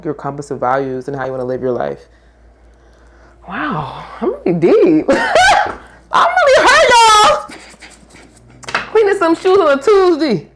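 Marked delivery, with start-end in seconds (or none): 3.5-10.5 high, loud mock whine; whiny voice. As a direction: whine like a wronged child.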